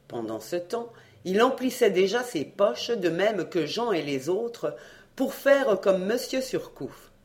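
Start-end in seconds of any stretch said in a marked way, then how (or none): none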